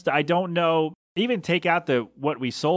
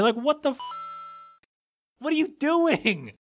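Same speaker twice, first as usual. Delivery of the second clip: incredulous outburst; 12 semitones higher than usual. Real, not acted. Venting to listeners about the candle guy, high incredulous cry. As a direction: throw the question up in total bafflement. Exasperated rant at the customer.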